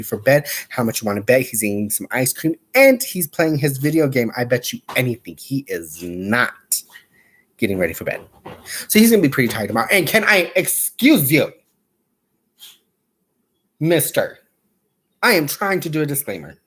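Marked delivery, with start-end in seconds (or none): 4.4-8.2 whispered gossip to viewers; conspiratorial whisper; whispered aside. Real, not acted. Gossiping about co-host. 9.9-14.4 slow, higher sassy excuse me; mock-offended scold; sassy excuse you. Real, not acted. Scolding the dog.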